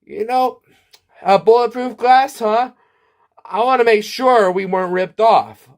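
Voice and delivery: high mock-proud declaration; high-pitched declaration. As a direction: declare it like a smug know-it-all. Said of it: "and declared".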